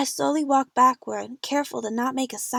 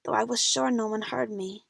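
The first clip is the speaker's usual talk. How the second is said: plain reading voice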